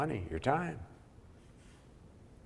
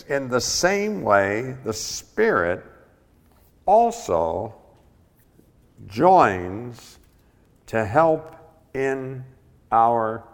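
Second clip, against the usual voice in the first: read slowly; 3 semitones higher, 9 dB louder, much slower than usual. Shown as a demonstration. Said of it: "Let me read that slowly".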